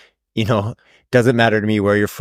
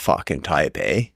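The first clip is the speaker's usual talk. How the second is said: light touch of Canadian accent; mildly put-on Canadian; 5 semitones lower, 3 dB quieter than usual. Shown as a demonstration. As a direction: lean into the Canadian eh. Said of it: "I always still have a Canadian accent".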